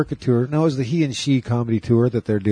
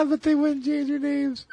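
squeaky high voice through laughter; laughing; 3 dB quieter than usual. Real, not acted. Host tickled by co-host's band-name joke, laughing. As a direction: squeeze the words out while laughing.

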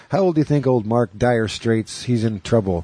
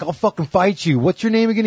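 imitating an angry man, much higher voice; mock outrage, high-pitched and riled up; retold pissed; 9 semitones higher than usual. Pissed husband threatening the prank caller, retold angrily. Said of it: "the guy's all pissed".

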